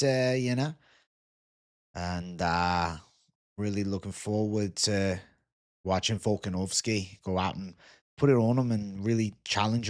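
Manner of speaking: put-on Aussie fight-preview host; Australian accent. Streamer previewing fights for Aussie lads, Australian accent. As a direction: break down the card like a laid-back Australian. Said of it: "if you had an Australian accent".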